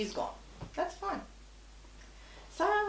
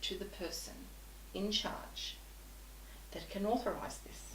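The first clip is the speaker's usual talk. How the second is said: calm quiet request; 10 dB quieter than usual. Retold or imitated. Calm request after outburst.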